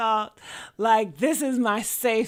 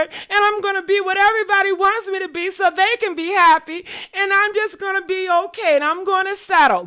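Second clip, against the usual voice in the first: high, pleading mock voice of someone giving up; mock the weak version of herself, voice pitched up and near tears; whiny caving self; 8 semitones higher, 5 dB louder than usual. To her viewers, mocking who she refused to be, high and whiny.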